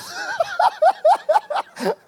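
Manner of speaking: long falling laugh; high laughing. Real, not acted. Preacher laughing at his own shout.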